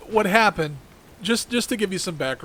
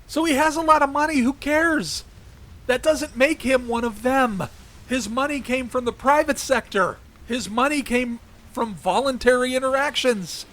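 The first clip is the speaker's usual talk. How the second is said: worked up, higher and fast; heated rant; 9 semitones higher than usual. Real, not acted. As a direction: rant with rising anger. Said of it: "calm down a little bit".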